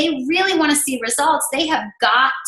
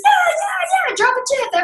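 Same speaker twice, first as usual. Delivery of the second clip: squealing, much higher yeah yeah yeah; eager group reply. Retold or imitated. Group members cheering the challenge, high squealing excitement. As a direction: gush like thrilled challengers answering.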